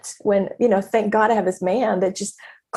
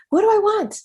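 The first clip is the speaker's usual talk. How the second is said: much higher, bright searching question; eager question; 11 semitones higher than usual. Shown as a demonstration. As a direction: ask yourself with sudden eagerness. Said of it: "Literally ask yourself that".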